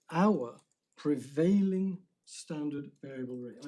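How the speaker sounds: slow, deliberate phrase with "prevailing" punched out; stress on PREVAILING. Real, not acted. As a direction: set "Our" apart, then lean hard on "prevailing". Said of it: "I emphasize prevailing".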